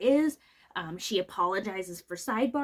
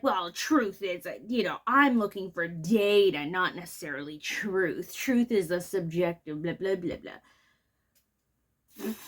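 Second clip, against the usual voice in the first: slow, lower mock of a pompous expert; 4 semitones lower than usual. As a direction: parody the witness as he drags it out. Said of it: "sitting there saying stuff like".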